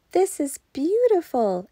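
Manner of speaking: a touch of a pleased wife; Yasmin's voice. A narrator's reading. Yasmin's voice in story.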